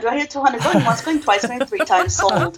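high, lively auctioneer calls. Shown as a demonstration. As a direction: rattle off the bids, climbing to sold. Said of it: "an auctioneer".

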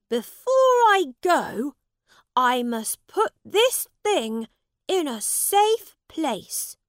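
high, loud princely voice; determined young prince. Acted. Prince resolves before leaving.

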